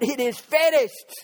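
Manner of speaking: high, loud outburst imitating Jesus; preacher's sudden cry. Preacher voices Jesus at the cross, crying out. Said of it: "And Jesus cried out".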